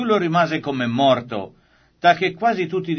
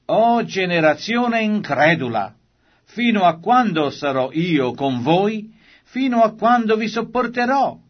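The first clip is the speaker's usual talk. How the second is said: rising exasperation, pitch climbing higher to a cry; Jesus cries out in weary exasperation; exasperated rebuke; 7 semitones higher, 4 dB louder than usual. Acted. Jesus rebukes the faithless crowd.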